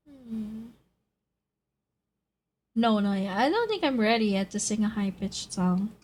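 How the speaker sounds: ordinary talk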